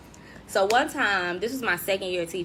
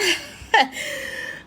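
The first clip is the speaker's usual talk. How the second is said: laughing at comments; 8 semitones higher than usual. Real, not acted. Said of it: "I'm laughing at comments".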